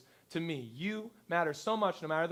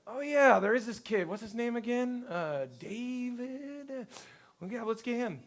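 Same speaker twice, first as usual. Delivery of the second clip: higher-pitched, vague recollection; forgetful father; 5 semitones higher than usual. Acted. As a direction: dredge up the name, dragging it out. Father barely remembers forgotten son.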